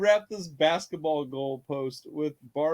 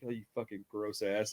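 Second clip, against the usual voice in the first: disgusted; 7 semitones lower, 11 dB quieter than usual. Retold or imitated. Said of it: "She's like".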